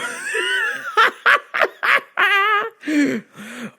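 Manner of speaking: high-pitched burst of real laughter; big cackle. Real, not acted. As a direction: crack up at your own bit. Laughing at own impression.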